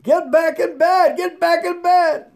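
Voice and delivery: high, very loud frantic holler. A narrator's reading. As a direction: holler it like an alarmed nurse ordering a patient back. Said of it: "they started hollering".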